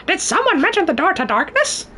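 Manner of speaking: very high cartoon voice; put-on character voice. Shown as a demonstration. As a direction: ask it in a squeaky cartoon falsetto. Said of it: "As for other voices".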